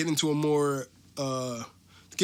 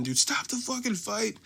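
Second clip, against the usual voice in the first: retold scream; 8 semitones higher than usual. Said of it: "I start screaming".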